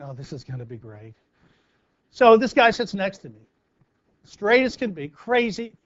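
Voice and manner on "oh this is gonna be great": muttered sarcasm, much softer and lower; dry, low grumble; sarcastic. Retold or imitated. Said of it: "he's looking down at me thinking".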